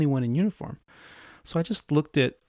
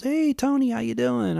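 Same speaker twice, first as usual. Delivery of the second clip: cheery captain greeting; 12 semitones higher than usual. Retold or imitated.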